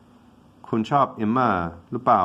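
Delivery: rattled off quickly in Thai; quick Thai gloss of the question; fast Thai translation. Shown as a demonstration. Teacher translating for Thai learners, quickly. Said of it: "I'm not sure if you like her or not".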